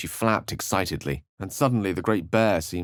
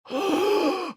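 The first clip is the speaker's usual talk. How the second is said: sharp high gasp. Acted. Sudden startled reaction.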